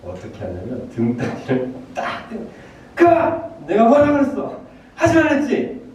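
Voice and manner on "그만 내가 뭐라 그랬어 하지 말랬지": high, loud, exasperated scolding; scolding Korean parent. Acted. Korean parent scolding a child in trouble, high angry shout. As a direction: yell like a parent telling off a child. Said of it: "한국 부모님들은".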